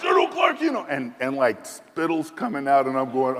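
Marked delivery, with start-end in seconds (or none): 0.0-0.8 very high, raised imitation shout; angry shout. General yelling at the officer, very high. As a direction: spit it out angrily as the general.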